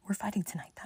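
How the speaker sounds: whispered to herself. Retold or imitated. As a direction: breathe it out under your breath. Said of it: "whispered to herself".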